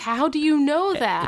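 high, falling mock disbelief; incredulous question. Friends baffled by what Sean knows, incredulous.

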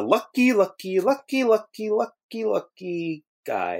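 drawn-out "lucky", climbing high then sinking; misquoted cartoon line. Quoting SpongeBob's lucky line, slow high singsong. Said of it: "No, it's lucky".

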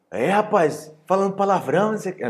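father scolding son for swearing at the table. Retold or imitated. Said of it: "E meu pai, tipo".

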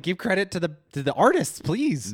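high, loud, pleading insistence; exasperated plea. Retold or imitated. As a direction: implore them in mock outrage. Retold plea to credit the artists.

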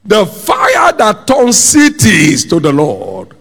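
high, slow preaching cry; forceful preaching. Real, not acted.

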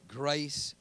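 usual speaking voice

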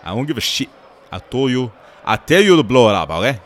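loud, high, furious order; angry Vader shout. Retold or imitated. Vader shouting at his men, loud, high and furious. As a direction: bellow the order at the troops. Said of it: "He's like".

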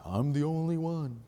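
soft, deadpan mock of the whine; mocking repeat. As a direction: flatly parrot the complaint, unimpressed. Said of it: "God's not impressed".

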